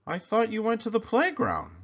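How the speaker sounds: a dad surprised his son is home; father's voice. A narrator's reading. Father asking his son, lightly surprised.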